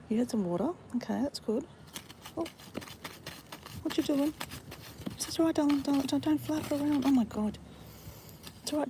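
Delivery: high, sing-song coaxing of a pet bird; pet talk. Real, not acted. Owner coaxing her injured bird to eat, high baby talk.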